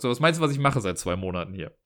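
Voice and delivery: normal talking voice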